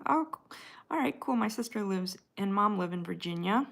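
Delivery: normal talking voice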